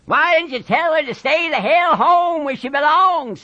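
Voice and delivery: high, strained ranch-hand voice; goading Carlson. Acted. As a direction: needle him, pitched high and forceful. Carlson goads Curly.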